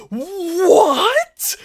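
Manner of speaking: long, high, rising shout of confusion; stunned disbelief. Real, not acted. Viewer so lost at the plot twist, stunned disbelief. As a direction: blurt it out loud, utterly baffled.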